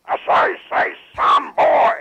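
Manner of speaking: loud, slow, blustery rooster drawl; Foghorn Leghorn. Retold or imitated. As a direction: boom it out like a cartoon rooster. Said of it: "Kind of Foghorn Leghorn".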